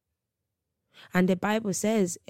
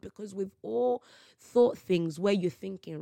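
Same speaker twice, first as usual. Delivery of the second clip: ordinary delivery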